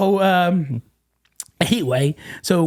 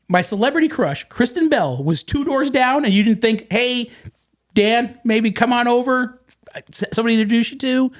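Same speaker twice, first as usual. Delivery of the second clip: raised pitch, indignant disbelief; incredulous protest; 7 semitones higher than usual. Retold or imitated. Husband confronting friend over missed celebrity crush, incredulous. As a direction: replay the complaint in mock outrage. Acted protest to Nicole in story.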